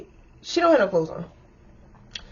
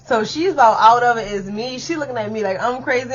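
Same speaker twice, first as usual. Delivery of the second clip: usual speaking voice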